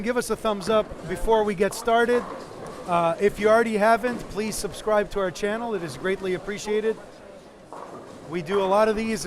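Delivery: loud upbeat stream host; announcer voice. Acted. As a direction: project like a live broadcast announcer. Stream host addresses viewers.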